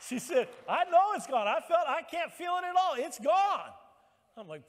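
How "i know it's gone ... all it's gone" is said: high, loud, shouted excitement; all excited. Retold or imitated. Retold friend insisting she is healed, shouting all excited. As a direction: imitate an overjoyed friend shouting with certainty. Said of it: "And she's all excited".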